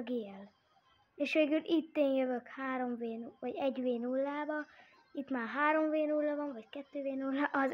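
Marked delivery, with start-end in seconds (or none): none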